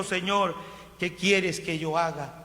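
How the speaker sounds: ordinary talk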